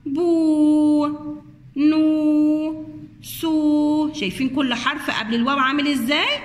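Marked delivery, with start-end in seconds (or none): 0.1-4.1 بو، نو stretched with rounded lips; rounded long oo. Shown as a demonstration. Teacher showing children the rounded long oo. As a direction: purse the lips and hold the oo in بو. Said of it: "ضم بقك كده وضم أول شفايفك".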